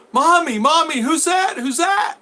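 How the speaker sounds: put-on high, curious little kid; child's voice. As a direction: ask it like a toddler tugging mom. Toddler voiced by teller.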